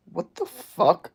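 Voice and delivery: high-pitched, drawn-out shock; stunned disbelief. Real, not acted. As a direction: stretch it out high, utterly weirded out. Reacting to bizarre chat message.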